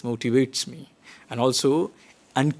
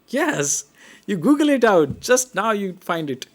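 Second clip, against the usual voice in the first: ordinary talk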